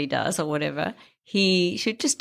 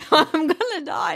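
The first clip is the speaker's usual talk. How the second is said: high, drawn-out imitation of a horrified patient; mock alarm; 10 semitones higher, slower than usual. American patient to doctor, mock horror. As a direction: retell it with pitched-up dismay. Patient believes she will die.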